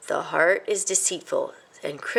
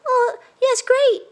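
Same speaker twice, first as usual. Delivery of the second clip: high, louder forced cheer; miserable mouse; 7 dB louder, slower than usual. A narrator's reading. A miserable mouse fakes delight, high and forced. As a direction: fake the enthusiasm miserably. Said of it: "said Christopher miserably".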